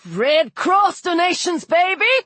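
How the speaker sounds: high, whooping yell. A narrator's reading. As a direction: crow it out loud and high. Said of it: "the ghost yelled".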